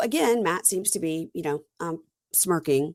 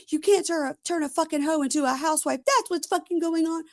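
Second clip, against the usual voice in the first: mock dramatic rant; 10 semitones higher than usual. Mocking cast member's tirade.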